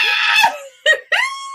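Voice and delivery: real high, loud squeal of laughter; giddy squealing laughter; shrieking laugh. Real, not acted. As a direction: burst out in delighted shrieks. Readers react to pregnancy reveal.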